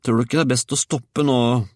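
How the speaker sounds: put-on high, loud child's call; boy calling out. A narrator's reading. Georg to grandmother up on the roof, high call. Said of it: "ropte Georg".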